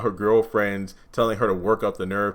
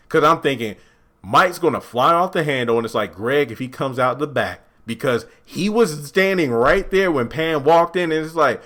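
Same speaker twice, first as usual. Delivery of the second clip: ordinary delivery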